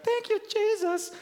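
very high, loud imitated yelp; mom's cry. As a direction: squeal it high like the stubbed-toe mom. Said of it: "she's like".

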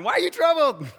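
high, loud, playful question; smiling, teasing. Acted. Jesus to his terrified disciples, smiling and amused. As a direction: call it out through a big smile. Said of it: "I think he was smiling".

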